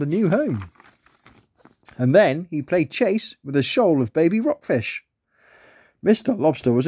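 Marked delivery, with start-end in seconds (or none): none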